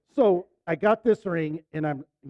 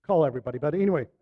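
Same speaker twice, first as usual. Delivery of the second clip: ordinary delivery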